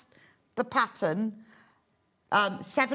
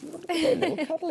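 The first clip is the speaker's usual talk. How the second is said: cooing; 10 semitones higher, faster than usual. Pretend. Cuddling the teddy bear.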